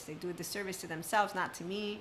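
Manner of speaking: ordinary talk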